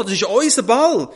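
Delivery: loud, high protest; loud children demanding their ball back; shouting. A narrator's reading. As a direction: call after him, indignant. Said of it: "rufen die anderen".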